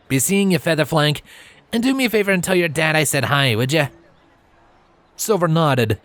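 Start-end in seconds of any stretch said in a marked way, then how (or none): 0.1-3.9 higher, quick, cheeky stallion. Acted. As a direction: toss it off cheerfully. Trailblazer's farewell voiced.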